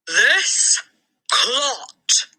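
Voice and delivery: high booming scorn; boomed. A narrator's reading. The headmistress booms insults at the boy. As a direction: boom it at the whole hall. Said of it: "boomed the headmistress".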